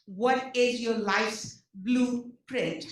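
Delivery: each word of "What is your life's blueprint?" drawn out slowly; slow careful repeat. Real, not acted. Repeating the question to the audience for clarity, slow and deliberate. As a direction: spell it out slowly so nobody misses a word. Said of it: "Remember, I said I have an Indian accent, so I'll repeat that question".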